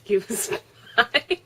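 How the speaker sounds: slow words through laughter; laughing while speaking. Real, not acted. Laughing at own story.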